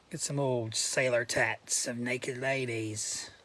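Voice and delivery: slow, low, put-on British; accidental British accent. Collector describing sailor tattoos, slipping into British. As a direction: slip lazily into a British accent. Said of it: "Don't know why I'm going British".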